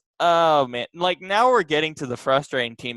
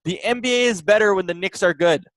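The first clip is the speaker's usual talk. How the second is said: raised, emphatic, fed-up voice; frustrated rant; 6 semitones higher, 4 dB louder than usual. Real, not acted. Non-fan insisting the NBA needs good Knicks, frustrated. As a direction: press every point with real exasperation. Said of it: "but I'm frustrated because guess what?".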